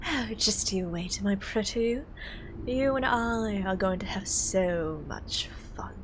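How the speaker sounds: soft, whispered villain threat; sneering panto villain. Acted. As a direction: menace them in a hushed whisper. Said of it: "I'll adjust my voice".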